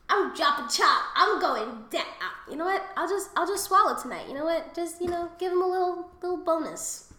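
half-sung cheeky promise; playful excited resolve. Retold or imitated. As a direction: hype yourself up mischievously. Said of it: "And I'm just like".

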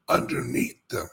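normal talking voice

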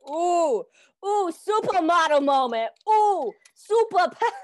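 high, loud, showy ooh; mock runway diva; supermodel moment. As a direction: strike a pose and coo it out. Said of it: "Supermodel, supermodel mo".

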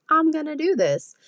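high, eager pitch jump on the quote; imitating past eager self. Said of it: "I was like".

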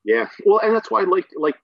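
normal talking voice

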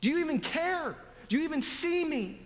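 high, loud desperate cry; pretend scream at God. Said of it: "you can just be screaming at him".

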